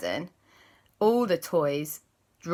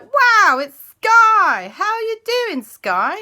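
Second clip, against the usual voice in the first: high, loud excited greeting; excited boys; 11 dB louder, slower than usual. Acted. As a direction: shout a happy hello. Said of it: "Alexander and Christopher were like".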